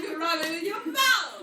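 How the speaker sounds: loud, high laughing tease. Real, not acted. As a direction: crack up while teasing. Laughing teasing the head.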